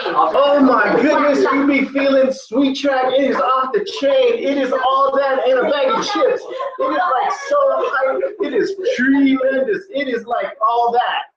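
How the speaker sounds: high-pitched, over-the-top hyped raving; enthusiastic hype. Acted. Teacher acting out enthusiasm about Sweet Track, high hyped voice. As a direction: gush like a hyped-up fan. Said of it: "That's enthusiastic there".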